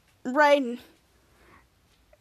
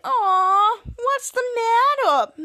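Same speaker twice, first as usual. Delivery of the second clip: slow, high, cooing mock baby voice; baby talk; 8 semitones higher, much slower than usual. Shadow taunting the beaten Drex, in mock baby talk. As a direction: coo at him like a toddler, stretched out. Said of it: "more baby talk".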